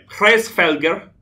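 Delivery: put-on drawn-out Norwegian-accented Hrevelgar. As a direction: say the name like a Norwegian. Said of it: "your most Norwegian accent".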